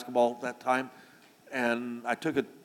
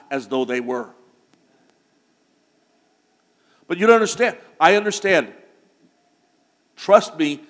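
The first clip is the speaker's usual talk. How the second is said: loud, emphatic exhortation to the congregation; urgent full-voiced pulpit charge; forceful preaching; 3 semitones higher, 7 dB louder than usual. Real, not acted. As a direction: preach it hard, louder and insistent.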